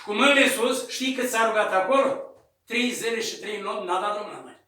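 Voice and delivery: ordinary delivery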